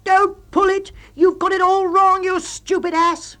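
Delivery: high, loud, and slowing on the insult; loud high yell ending in an insult; furious shout. A narrator's reading. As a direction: bellow at him in exasperation. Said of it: "you shout".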